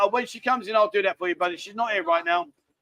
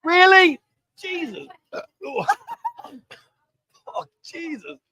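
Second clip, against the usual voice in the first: coughing and gasping with laughter; spluttering after a spit take; 9 semitones higher, 5 dB louder, slower than usual. Real, not acted. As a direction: sputter through the drink. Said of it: "I've spat it all over me computer".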